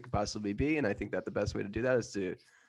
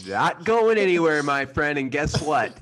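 loud, high mock threat; villain trapping robber, loud and gleeful; menacing; 6 semitones higher, 8 dB louder, slower than usual. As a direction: corner him, savoring it.

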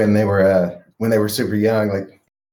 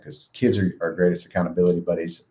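ordinary talk

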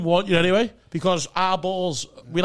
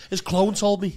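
normal talking voice

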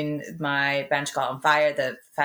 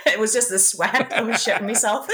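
higher-pitched, laughing through the words; real giggles while confessing; 4 semitones higher than usual. Real, not acted. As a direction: barely get it out for laughing. Cracking up at own joke.

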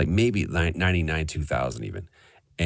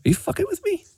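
much higher, stunned mock seatmate; incredulous disbelief; faster than usual. Ad man hearing his campaign praised, high incredulous squeak. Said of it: "And he goes".

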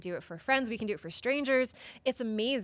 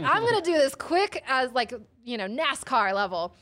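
excited; 4 semitones higher, 7 dB louder than usual. Retold or imitated. Psyched to change tire fast.

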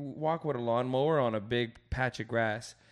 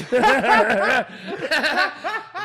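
loud laughing fit; 13 semitones higher, 14 dB louder than usual. Real, not acted. Laughing at the remembered joke.

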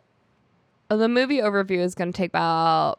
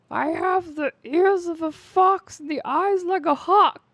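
high, slow boastful voice; hammed-up boast, drawn out in a raised voice; Gimli; 9 semitones higher, slower than usual.

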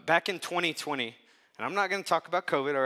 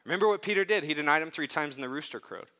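ordinary talk

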